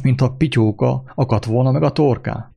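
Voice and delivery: ordinary talk, nothing marked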